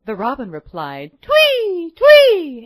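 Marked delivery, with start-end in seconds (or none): 1.2-2.6 high, loud chirping birdcall; bird chirp. A narrator's reading. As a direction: chirp it like a little robin. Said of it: "in his own voice".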